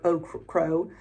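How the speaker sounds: usual speaking voice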